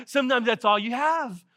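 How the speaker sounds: ordinary talk, nothing marked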